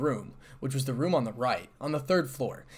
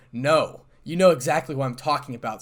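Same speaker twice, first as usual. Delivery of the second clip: louder, firm irritated reply; anger seeping in; 9 dB louder than usual. A narrator's reading. Issei contradicting Azazel, anger creeping in. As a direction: let anger seep into the tone. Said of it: "anger seeping into his tone".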